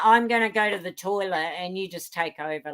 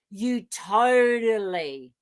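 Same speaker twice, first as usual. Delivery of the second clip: slow teasing drawl; much slower than usual. Real, not acted. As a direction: draw it out, very slow. Teasing, drawn out slowly.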